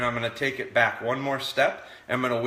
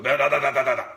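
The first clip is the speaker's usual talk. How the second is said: louder, sharper da-da-da; firm, louder rhythmic da da; picked-up correction; 5 dB louder, much faster than usual. Shown as a demonstration. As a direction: step the correction up firmly. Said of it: "I can pick it up just to".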